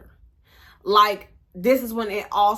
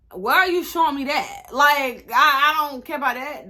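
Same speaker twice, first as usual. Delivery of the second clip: annoyed outburst; 5 semitones higher, 5 dB louder than usual. Retold or imitated. Annoyed at boyfriend's homepage scrolling.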